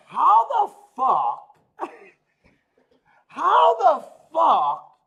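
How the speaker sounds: slow, drawn-out, high and loud outraged cry; losing it in disbelief. Retold or imitated. Retelling his outburst at his friend on the show, slow incredulous yell. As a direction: stretch each word out in outraged disbelief. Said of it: "I almost lost my shit on him".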